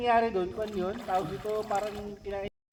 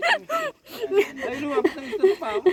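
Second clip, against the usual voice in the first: laughing; 8 semitones higher, 4 dB louder, slower than usual. Real, not acted. Fish approaching, startled laugh.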